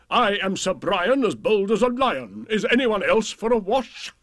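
high, swaggering challenge; hammed-up bully knight bragging; boastful knight. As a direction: lord it over the villagers.

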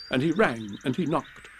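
plain reading voice

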